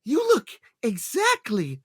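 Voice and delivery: a hint of old lady. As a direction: coo it like a fan granny. Imitating little old lady.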